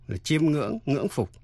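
usual speaking voice

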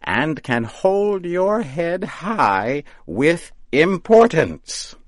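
high, slow, rising to loud; grand witch. A narrator's reading. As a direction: puff him up with pride. Witch sends him off.

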